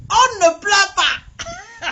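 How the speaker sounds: loud, drawn-out words breaking into laughter; laughing. Real, not acted. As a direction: laugh through the order. Laughing while forbidding tears. (en riant, lentement et plus fort)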